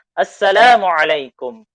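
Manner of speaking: loud, clearly sounded greeting. Shown as a demonstration. Teacher modelling a loud greeting for students to repeat. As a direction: say it out loud for the class. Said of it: "dibacanya dengan suara yang lantang".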